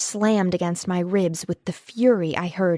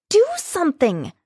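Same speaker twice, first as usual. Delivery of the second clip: much higher, urgent shout; 9 semitones higher, 3 dB louder than usual. A narrator's reading. She shouts at Lucas to break up the fight, much higher. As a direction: yell at him to act. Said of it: "I shouted at him".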